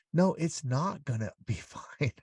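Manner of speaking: half-laughing protest at empty comfort; exasperated retort. Acted. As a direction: push back slowly with a laugh breaking in. Acted exasperated reply to false reassurance.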